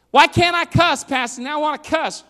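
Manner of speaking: high, pleading mock teenager; whiny teen. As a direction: play a kid begging the pastor. Voicing a teen asking pastor.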